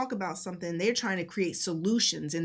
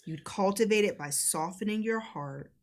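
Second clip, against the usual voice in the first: slow, measured delivery with long pauses; deliberate, reflective teaching read; reading out guidance; slower than usual. Acted. Reads out guidance on emotional safety.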